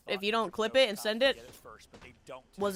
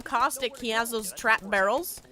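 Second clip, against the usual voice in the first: ordinary talk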